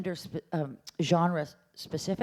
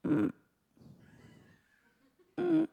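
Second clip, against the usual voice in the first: hoarse moan; 11 semitones higher than usual. Shown as a demonstration. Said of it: "cat has laryngitis".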